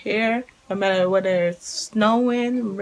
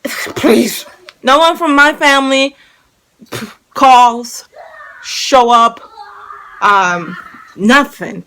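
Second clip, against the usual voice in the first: louder, emphatic complaint; exasperated rant; 4 semitones higher, 10 dB louder, slower than usual. Real, not acted. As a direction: vent about family not showing up. Ranting about her family.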